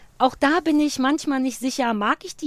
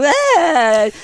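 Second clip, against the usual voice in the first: mock loud yell; 3 semitones higher, 8 dB louder than usual. Imitates shouting show guests.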